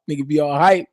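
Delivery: normal talking voice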